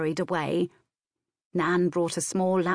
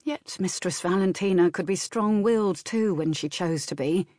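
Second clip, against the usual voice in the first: ordinary delivery, nothing marked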